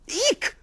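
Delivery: very high loud startled squawk; owl squawk. Acted. Owl crashing into the bear, squawking. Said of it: "squawked Mister Hoot-to-Wit".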